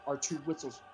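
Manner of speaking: normal talking voice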